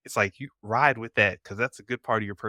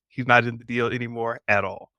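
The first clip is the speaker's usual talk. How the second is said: ordinary talk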